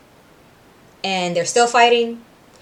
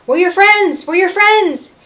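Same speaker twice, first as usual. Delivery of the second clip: loud, higher desperate appeal; urgent plea; 10 semitones higher, 5 dB louder than usual. Retold or imitated. Husk pleading with a hypnotized friend, loud and high. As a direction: shout to break through to him. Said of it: "He's like".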